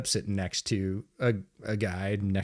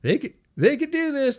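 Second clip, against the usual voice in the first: much higher, sarcastic mock hope. Bar fans sarcastically cheering on the Jets fan. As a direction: fake encouragement, dripping sarcasm. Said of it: "they start saying sort of sarcastically".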